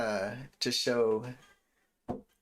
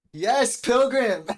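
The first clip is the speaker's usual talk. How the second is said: high, loud, stretched exclamation; excited holler to a fellow dad; delighted shout; 12 semitones higher, 11 dB louder, much slower than usual. Real, not acted. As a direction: call out in real delighted agreement. Delighted reply to a viewer.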